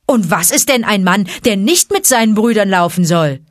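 put-on loud, indignant boy; angry. A narrator's reading. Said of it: "fragte Mowgli erbost".

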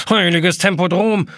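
hohe, laute, fremde Kalenderstimme; panischer Kalender. A narrator's reading. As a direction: heul es panisch auf. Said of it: "heult er auf". (panischer Kalender, hoch und laut)

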